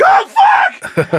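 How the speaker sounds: sudden high yelp with a laugh; excited outburst. Retold or imitated. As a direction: shout it out as the sixtieth homer lands. Retold jumping at the home run.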